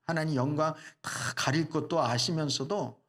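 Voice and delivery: usual speaking voice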